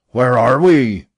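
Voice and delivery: captain repeating in purple rage; furious repeat. A narrator's reading. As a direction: spit the question out in a fury. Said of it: "he repeated with purple face".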